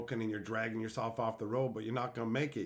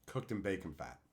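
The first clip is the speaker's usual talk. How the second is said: hushed aside; 7 semitones lower, 8 dB quieter than usual. Pretend. Continues the whisper bit.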